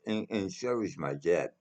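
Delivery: ordinary delivery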